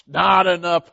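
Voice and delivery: "Not enough" called out loud and firm; loud order. Retold or imitated. Elijah shouting to the men pouring water: not enough. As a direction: call "Not enough" out across the crowd.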